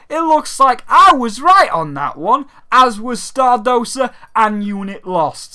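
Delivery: loud, high gloating; smug. Real, not acted. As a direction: crow about being right. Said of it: "I don't mean to sound too smug there".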